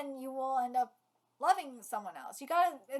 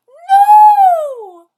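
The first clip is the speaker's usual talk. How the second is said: very high, very loud scream; screamed protest; 27 dB louder than usual. Acted. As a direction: scream it out at full voice. Character screams in the fight.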